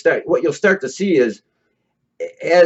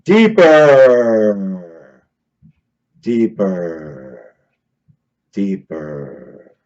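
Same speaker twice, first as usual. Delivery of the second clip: drawn out, dropping much lower and fading; sinking deeper; 9 semitones lower, much slower than usual. Shown as a demonstration. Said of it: "then he goes like this".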